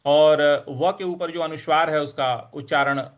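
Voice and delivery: normal talking voice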